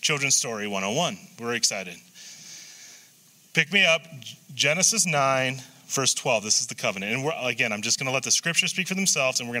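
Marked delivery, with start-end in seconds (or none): none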